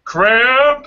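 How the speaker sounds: sudden high shout; cramps shouting. Retold or imitated. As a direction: yell it like the cramps attacking. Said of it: "and then they go".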